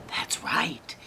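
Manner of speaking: ordinary delivery, nothing marked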